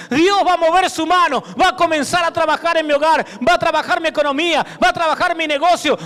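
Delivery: loud, rousing promise of victory; full-force preaching. Acted. Preacher rousing the congregation, full force. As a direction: lift the whole church up.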